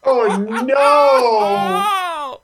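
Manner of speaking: high horrified wail. Real, not acted. Horrified reaction.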